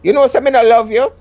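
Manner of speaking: a hint of Jamaican dismissal; Jamaican patois. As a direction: put on his patois plainly. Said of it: "I'm ten years older than him".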